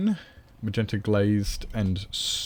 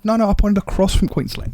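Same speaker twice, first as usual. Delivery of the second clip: excited squeal; 11 semitones higher, 7 dB louder, faster than usual. Real, not acted. Correcting herself in a squeal.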